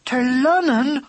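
high, slow horrified cry; in horror. Acted. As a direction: recoil at the very name. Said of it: "cried Katy in horror".

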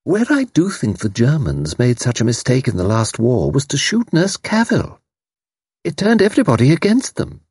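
put-on high, hesitant older-style chatter; dinner guest. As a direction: voice a high-pitched, opinionated guest.

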